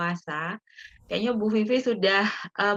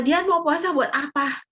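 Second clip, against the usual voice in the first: higher-pitched mock interrogation; questioning; 7 semitones higher than usual. Imagined questioner asking Dian why she fasts, questioning. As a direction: quote a probing question, pitched up.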